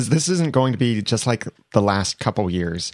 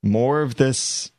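exasperated; 4 semitones higher, slower than usual. Retold or imitated. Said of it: "I thought was just like".

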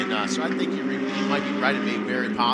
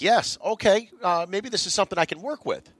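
excited; 7 semitones higher than usual. Retold or imitated. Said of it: "you're like".

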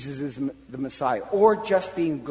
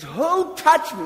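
loud, high, slower demand to the crowd; Jesus calling out; 11 semitones higher, 6 dB louder, slower than usual. Acted. Jesus asking the crowd once more, loud slow call. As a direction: draw it out, loud and searching.

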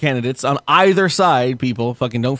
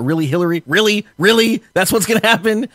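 rising, louder mock disbelief; 7 semitones higher, 3 dB louder than usual. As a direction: climb higher with each "Really?". Retold disbelief at Hillary.

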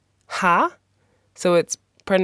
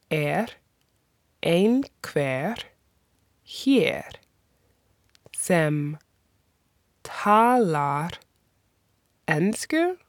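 Er einhver hér sem talar ensku broken into single words with long pauses; much slower than usual. Shown as a demonstration. Teacher breaking the question apart for learners, slowly. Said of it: "That is".

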